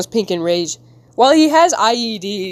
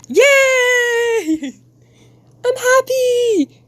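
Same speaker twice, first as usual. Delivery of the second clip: very high, drawn-out squeal of joy; gleeful; 3 dB louder, much slower than usual. Acted. Acting a happy comic character, high gleeful squeal.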